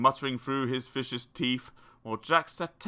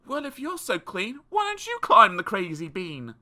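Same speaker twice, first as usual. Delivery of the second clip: put-on high, cheeky boy's voice; a boy talking back; Jack answering back; 8 semitones higher, 3 dB louder than usual. A narrator's reading. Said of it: "Jack answered".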